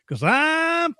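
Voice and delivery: high, slow, drawn-out "I'm fifty"; SNL "I'm fifty" bit. Retold or imitated. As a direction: squeal it out like the TV sketch lady. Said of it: "She goes".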